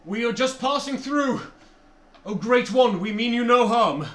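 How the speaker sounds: high, loud desperate plea; pleading. Acted. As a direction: beg the giant for mercy. Player pleads to the giant.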